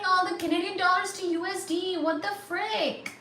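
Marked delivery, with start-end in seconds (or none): none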